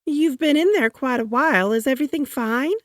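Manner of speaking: high-voiced fussing wife; worried wife. A narrator's reading. First Lady checking on her husband in the bath. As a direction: ask sweetly, faintly suspicious. Wife's voice held in story.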